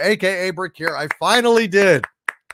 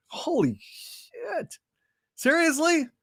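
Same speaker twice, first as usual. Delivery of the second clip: stunned; 11 semitones higher, 7 dB quieter, much slower than usual. Real, not acted. Stunned by viewer's ordeal.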